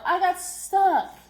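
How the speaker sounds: loud, high, slow cry of alarm; stuck and scared. Acted. Child stranded in the creek calling out, alarmed. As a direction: wail it, stranded on a rock.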